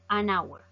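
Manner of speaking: "an hour" linked straight into the vowel; silent H. Shown as a demonstration. Teacher shows why it takes "an", silent H. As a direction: leave the H of "hour" silent after "an". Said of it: "Como no suena".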